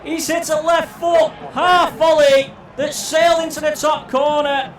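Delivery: screaming goal call at full pitch; long goal yell. Real, not acted. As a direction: roar the goal, stretch every word. Equaliser goal called out.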